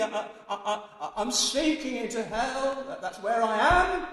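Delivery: high, loud, stammering panic; Jonah's cry from the depths, loud and high; desperate cry. Acted. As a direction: call out as if sinking. Jonah sinking into hell.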